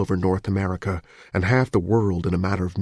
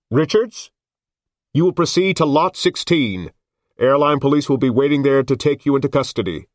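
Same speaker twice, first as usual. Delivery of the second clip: loud amplified orders; booming loudspeaker command; megaphone voice; 7 semitones higher, 5 dB louder than usual. Acted.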